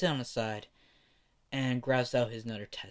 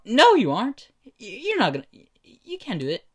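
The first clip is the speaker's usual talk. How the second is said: loud scoffing disbelief; 10 semitones higher, 8 dB louder than usual. Acted. Classmates scoff at Bakugo.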